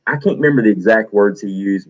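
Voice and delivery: ordinary delivery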